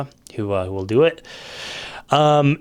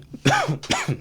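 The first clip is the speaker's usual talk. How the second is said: sudden sharp cough mid-sentence; a real, high, dry cough. Real, not acted. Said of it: "man, I'm coughing".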